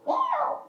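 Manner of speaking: tiny high-pitched mewing cry; mock kitten mew; kitten meow. Imitating the crying kitten.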